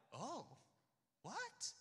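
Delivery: high, confused reaction; surprised. Retold or imitated. Most people hearing the news, baffled surprise. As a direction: imitate taken-aback friends, pitch leaping up on the question.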